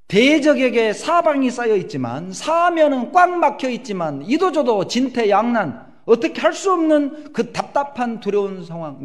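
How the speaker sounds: high, loud, slow proclamation; fiery preaching. Real, not acted. Preacher describing Stephen's besieged fear, at full force. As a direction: build it to a preaching peak.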